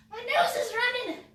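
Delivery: high, loud blurted announcement; comic outcry mid-workout; sudden exclamation. Real, not acted.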